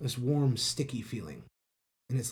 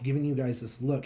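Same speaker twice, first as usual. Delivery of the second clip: ordinary delivery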